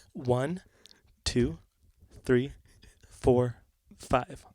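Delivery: quiet counting with long gaps; condescending counting. Retold or imitated. Sound guy counting outlets for the bassist, quietly condescending. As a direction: count it out as if for an idiot. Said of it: "looked at you like you were a fucking idiot".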